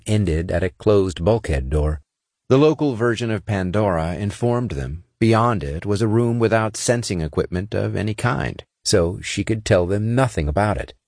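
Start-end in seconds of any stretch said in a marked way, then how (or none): none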